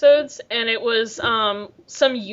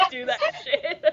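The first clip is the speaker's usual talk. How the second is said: high-pitched laugh through the words; cracking up while egging them on; 10 semitones higher, 6 dB quieter than usual. Real, not acted. Friends laughing at tongue rolling.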